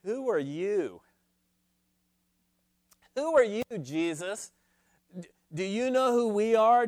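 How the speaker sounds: scornful religious elite demanding his credentials; haughty indignant challenge. Shown as a demonstration. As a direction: put him in his place, affronted. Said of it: "with an intonation something like this".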